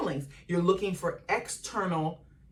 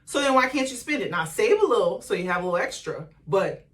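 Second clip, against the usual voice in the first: higher, louder, fast grandma voice; grandma urging granddaughter to spend, higher and louder; imitating grandma; 4 semitones higher, 6 dB louder than usual. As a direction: sweetly scold like a grandma. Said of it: "She's like".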